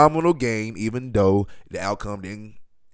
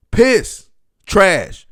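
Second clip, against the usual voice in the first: disgusted bark; 7 semitones higher, 8 dB louder than usual. Real, not acted. Disgust at team.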